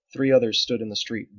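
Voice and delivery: usual speaking voice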